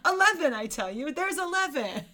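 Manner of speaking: high, loud announcer flourish; mock proclaiming. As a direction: proclaim it like a showman. Mock announcing the crew size.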